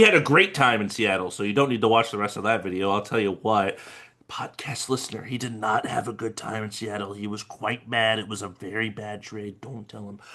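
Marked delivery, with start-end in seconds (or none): 4.3-5.2 whispered aside. Pretend. Aside to listeners mid-call. 5.2-10.2 hushed aside. Pretend. Aside to listeners mid-call.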